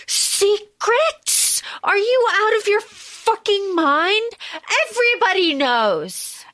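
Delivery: high, drawn-out incredulous fury; hammed-up rage, pitched well up; mock shaking rage. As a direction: act out someone scandalized and seething. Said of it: "shaking with rage".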